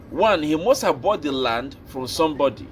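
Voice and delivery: usual speaking voice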